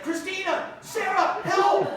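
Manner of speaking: hammed-up desperate shouting; mock panicked yell. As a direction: call out like a panicking friend. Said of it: "he yells out the shaman's name".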